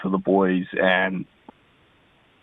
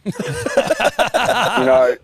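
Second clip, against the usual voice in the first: laughing; 11 semitones higher, 4 dB louder than usual. Real, not acted. Caller laughing at own joke.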